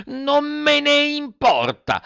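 very high, drawn-out bratty squeal; defiant Pinocchio. Acted. Puppet told he will die, squealing defiantly. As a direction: stretch it out as a childish tantrum. Puppet defies the fairy's warnings.

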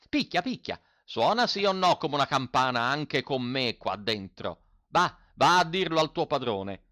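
put-on boastful old mender. A narrator's reading. Old mender dares the farmhand to test his mend, cocky and loud. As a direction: dare him to pull it apart.

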